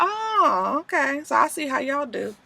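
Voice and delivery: high, soft, knowing sarcasm; snide mock-hurt aside; sarcastic okay. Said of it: "she's like".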